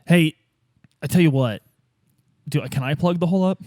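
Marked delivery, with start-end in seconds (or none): none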